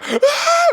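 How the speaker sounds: short high-pitched "ah" yelp; re-enacted scream. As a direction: yelp as if suddenly dropping. Acts out scream after pulling cord.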